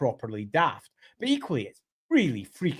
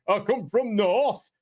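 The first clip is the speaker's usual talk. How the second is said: slow, higher-pitched mock Yorkshire dwarf; Yorkshire accent; 4 semitones higher, slower than usual. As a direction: draw it out in a broad Yorkshire accent. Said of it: "Don't forget Yorkshire".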